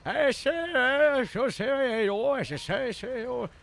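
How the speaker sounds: put-on a streamer demoing the old Harold voice; breathless old Harold. Shown as a demonstration. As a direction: wheeze out the stammered "I say" like a frantic old man. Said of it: "out of breath, old, losing his fucking mind".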